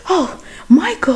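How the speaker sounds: startled whisper. Retold or imitated. Startled wife caught nervous.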